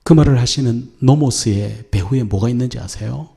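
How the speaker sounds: usual speaking voice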